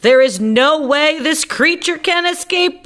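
high squeaky insistence; squeaky repeat. Acted. Doctor Varmint insists to the panicked crowd, high and squeaky. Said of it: "I repeat".

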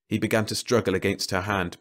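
usual speaking voice

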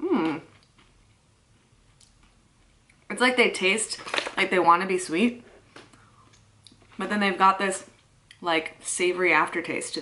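talking between bites; chewing while talking. Real, not acted. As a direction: mouth partly full. Seen 0: bites chip at -3, chews with grimace throughout, holding chip.

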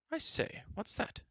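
very high, airy exclamation from a prince; astonished cry. A narrator's reading. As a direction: exclaim high in curiosity, without shouting. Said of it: "cried Prince Run".

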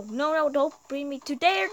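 ordinary talk

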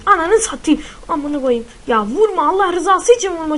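loud frantic pleas while being shot; panicked yelling. Real, not acted. Gamer yelling at an enemy not to shoot him.

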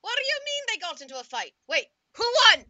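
high, loud eager question; eager dad. Pretend. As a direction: lean in, excited to hear who won. Dad voice hearing of fight.

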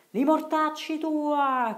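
very high, loud, slow Roman curse, hammed up; a joking curse hurled far; laughing shout. Marcello shouting a joking curse at faraway Riccetto. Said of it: "gridò ridendo Marcello".

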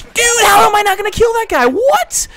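very high, loud yell of disbelief; shrieked gamer protest; outraged shout. Real, not acted. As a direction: scream in exasperated disbelief. Misses a kill, outraged disbelief.

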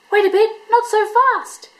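indignant little echidna; bristling Echidna. A narrator's reading. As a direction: stop them in their tracks. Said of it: "he bristled".

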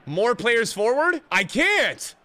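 high, loud protest; exasperated outburst. Real, not acted. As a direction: cry out in exasperation. Exasperated at tactics.

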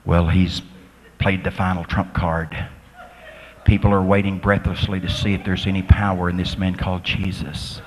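very low, hushed-drama announcer monotone; low, even commentary voice, holding suspense; sports announcer. Retold or imitated. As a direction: call it like a tense sports commentator. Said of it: "the sports announcer would say".

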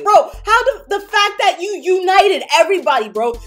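loud, high, fired-up rant; excited raving. Real, not acted. Fan raving to viewers about Rimuru, loud and excited. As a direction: gush about him like he's the greatest.

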